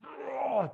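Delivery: long high mock retch; drawn-out imitation of throwing up; imitated vomiting. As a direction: imitate someone puking their guts out.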